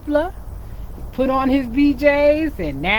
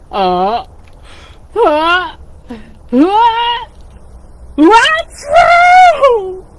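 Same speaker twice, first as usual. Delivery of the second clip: gasps rising higher and louder into a shout; hammed-up sneeze build for the kids; 10 semitones higher, 9 dB louder, much slower than usual. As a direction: wind up a big sneeze that explodes. Bear about to sneeze.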